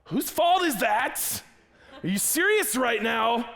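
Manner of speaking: high, loud outraged protest; slow, loud indignation at the clerks; indignant. Retold or imitated. Retold indignant reply to payroll.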